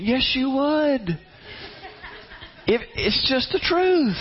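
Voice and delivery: high, slow singsong yes you would; teasing insistence. Real, not acted. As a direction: rub it in playfully, high and slow.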